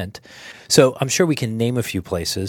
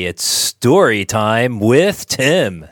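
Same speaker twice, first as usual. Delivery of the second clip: announcer voice; 6 dB louder, much slower than usual. Acted. Hosts' show intro announcement.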